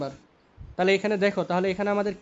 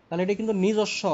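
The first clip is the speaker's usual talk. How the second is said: normal talking voice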